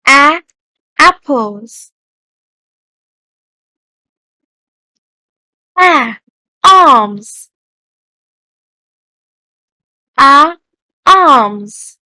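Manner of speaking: loud A sound, then the word; a loud opening A before each word for kids; teacher modeling. Shown as a demonstration. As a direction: isolate the A first, then the full word. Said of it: "Repeat after me".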